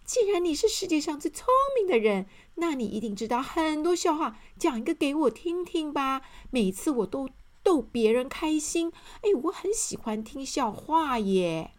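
high, fast, bright-eyed chatter; an overjoyed friend pleading for a story; eager happy character. Acted. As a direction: bounce with excitement, begging for a joke. Excited character asks for jokes.

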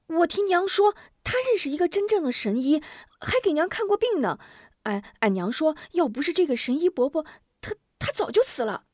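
put-on high, fast child voice; eager child. A narrator's reading. Boy telling the healer about the doctor, eager. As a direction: blurt it out like a small boy.

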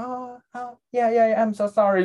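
mock plumber apologizing; imitating the plumber. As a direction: play the sheepish plumber. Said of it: "然后他就说".

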